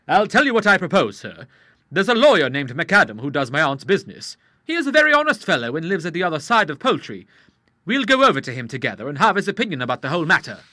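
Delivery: put-on a suspicious young man speaking out; Charles. A narrator's reading. Charles to the Admiral in the street, lightly voiced and uneasy.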